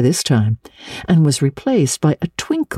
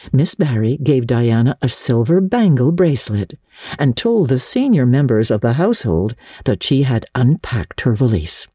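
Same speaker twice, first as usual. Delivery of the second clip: plain reading voice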